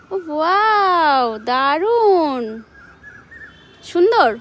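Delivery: very slow, loud, drawn-out wow; delighted praise. Real, not acted. Mother gushes over the flower Nabiha picked, loud and slow.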